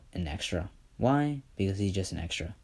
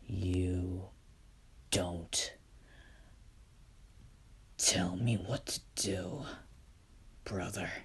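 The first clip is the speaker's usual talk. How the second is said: quiet, menacing refusal; hissed defiant whisper; 2 semitones lower, 11 dB quieter, slower than usual. Acted. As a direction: whisper it back, cold and defiant. Izuku defies Enoch.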